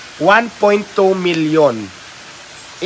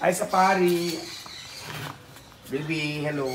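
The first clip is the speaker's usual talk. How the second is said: soft, hushed coo to a pet; whisper-soft endearment to the animal; tender cooing; 10 dB quieter, slower than usual. Real, not acted. Cooing baby talk to animal.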